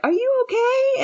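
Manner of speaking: high, cried-out mock concern; mock worried friend. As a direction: play the friends fussing over her. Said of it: "you guys are like".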